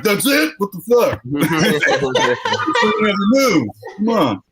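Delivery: high-pitched mock disbelief; imitated indignant complaint; mock indignation. Imagines man indignant at early finish.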